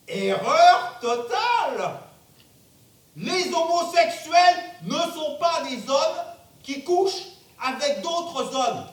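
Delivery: loud, slow, emphatic correction; forceful rebuttal. Acted. Roy forcefully corrects his doctor.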